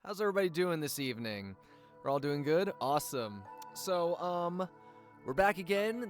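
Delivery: ordinary talk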